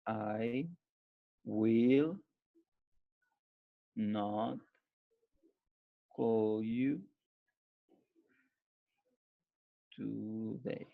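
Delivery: I will not call you today, spaced word by word with long pauses; word-by-word dictation. Shown as a demonstration. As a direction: dictate each word separately so they can write it down. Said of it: "dictates word by word, then whole".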